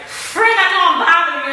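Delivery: mimicking friend. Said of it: "she was like".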